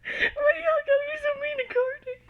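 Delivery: high, loud, wailing laugh-shout; laughing shriek. Real, not acted. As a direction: cry it out through hysterical laughter. Reader shrieks laughing at character.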